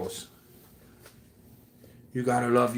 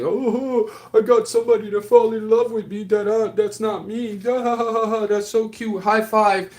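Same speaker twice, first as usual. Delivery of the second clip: high, loud, smug imitation of a liar bragging; mocking a gloating liar; 8 semitones higher, 5 dB louder than usual. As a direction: ham up the prankster gloating over the fool he tricked, laughing. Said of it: "so they can laugh later and say".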